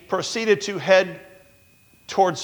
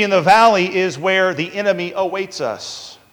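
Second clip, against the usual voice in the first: ordinary talk, nothing marked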